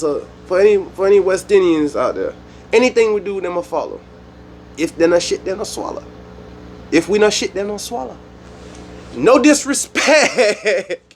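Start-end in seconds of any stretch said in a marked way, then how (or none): 2.7-8.2 higher-pitched West Indian patois quote. Retold or imitated. Quoting a deejay to West Indians, mock Jamaican patois. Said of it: "let me quote [name]". 9.1-11.1 high, drawn-out word breaking into laughter; laughing disclaimer. Real, not acted. Excusing his joke to West Indians, laughing through it.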